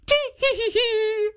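put-on high little old woman giggle. A narrator's reading. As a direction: giggle she can't hold back. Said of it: "still laughing".

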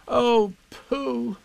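high, drawn-out lament; lamenting boy. A narrator's reading. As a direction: cry out for a missing friend. Boy laments missing friend.

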